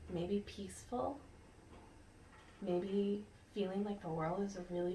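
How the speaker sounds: gentle whispered suggestion; soft whisper. Real, not acted. Teacher offering the class a peaceful feeling, whispered. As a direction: whisper it like a calm secret.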